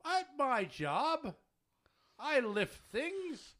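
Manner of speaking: high, very slow and pointed; sarcastic explaining. Real, not acted. Answering coworkers about heavy lifting, sarcastic. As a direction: spell it out as if for a dimwit.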